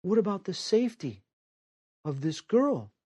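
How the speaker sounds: high, soft voice in his head; a hushed voice from inside, gently correcting him; inner voice. Retold or imitated. An inner voice tells Chris to protect the girl, high and hushed. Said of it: "a voice says".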